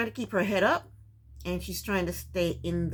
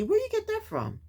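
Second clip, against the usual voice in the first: incredulous; 14 semitones higher than usual. Real, not acted. Vlogger reacting incredulously.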